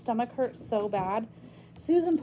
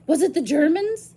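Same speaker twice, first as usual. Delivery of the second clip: alarmed question; 6 semitones higher, 10 dB louder than usual. Acted. Jamie asks anxiously.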